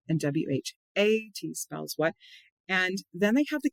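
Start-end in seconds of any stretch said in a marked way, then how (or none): 0.2-2.1 slow cue, "A" stretched out; teacher's spelling cue with emphatic "A"; stress on A. Shown as a demonstration. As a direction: spell it out slowly, leaning on "A". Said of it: "I emphasize the A".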